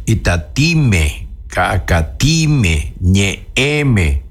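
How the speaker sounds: slowly, syllable by syllable, "Itatime, cacatime, ñeeme"; drawn-out model pronunciation; slow model words. Shown as a demonstration. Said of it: "vamos al ejemplo otra vez".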